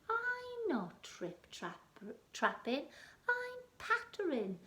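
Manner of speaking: high, slow squeaky mouse. Acted. Mouse answering the troll, high and small.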